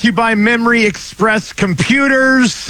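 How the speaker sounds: high energetic radio intro; punchy sponsor read; announcer voice. Real, not acted. Radio host opening announcement.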